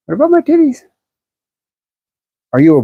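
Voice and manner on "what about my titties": much higher, squeaky put-on girl voice; hammed-up falsetto tease; mocking a crude comment in a high girly voice. Answers a crude viewer comment.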